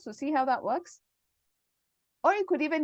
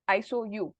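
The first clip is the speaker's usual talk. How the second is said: ordinary talk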